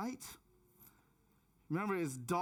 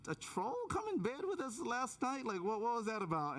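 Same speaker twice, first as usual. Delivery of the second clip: higher-pitched, baffled question; mock bewilderment; 6 semitones higher than usual. Husband asking wife about the night's troll, mock bewildered. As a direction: ask it puzzled, voice lifting high.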